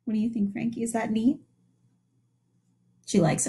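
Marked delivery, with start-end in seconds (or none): none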